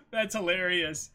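amused laugh into the line. Real, not acted. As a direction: still cracking up as you say it. Laughing at joke.

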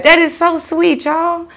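usual speaking voice

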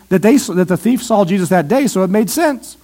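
normal talking voice

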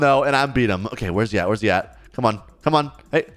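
normal talking voice

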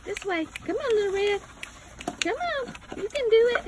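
very high sing-song puppy coaxing. Real, not acted.

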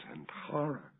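"horror" breathed out as a whisper. A narrator's reading. Narrator confessing his consuming thought, in a whisper. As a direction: let the word escape in a hushed breath.

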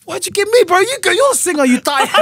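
high, loud mock panic; panicked protest. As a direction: squeak it out like you're trapped on stage. Retold panic at being called.

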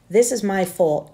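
usual speaking voice

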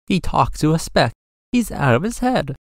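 lightly voiced gossip, pitched high; gossiping jungle animal. A narrator's reading. Jungle gossip about Horton spreads.

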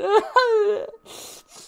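high mock sob-laugh; mocking his girlfriend's crying, high wail. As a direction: imitate her ugly crying. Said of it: "She looked fucking ugly".

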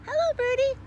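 high, loud, sing-song hello; excited high greeting. Acted. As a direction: call out sweetly to the bird. Character greets bird sweetly.

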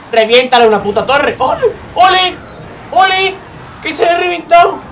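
loud, high-pitched gamer celebration; real whoops of excitement; excited shouting. Real, not acted. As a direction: shout it, cheering the attack on as it lands. Cheering on a tower attack.

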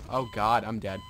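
ordinary talk, nothing marked

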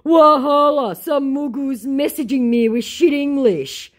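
high, far Nigerian pidgin mockery; mock him in a Nigerian accent.

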